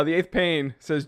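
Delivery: usual speaking voice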